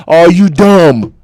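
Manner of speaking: slow, loud, drawn-out yell; angry yell. Real, not acted. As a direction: hammer each word at the screen. Angry rant at a player.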